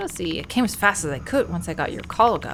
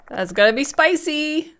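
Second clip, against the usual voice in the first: excited; 9 semitones higher, 6 dB louder than usual. Real, not acted. Reacting to the bloody curtain.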